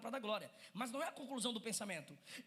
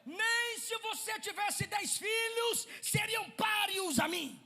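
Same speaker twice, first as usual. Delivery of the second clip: slow, higher boastful shout; proud macho husband; 7 semitones higher, 6 dB louder, slower than usual. Acted. Husband bragging to his wife, a slow proud shout. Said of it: "Bichão mesmo".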